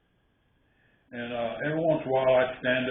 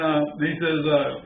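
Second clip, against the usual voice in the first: normal talking voice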